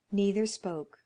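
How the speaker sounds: ordinary delivery, nothing marked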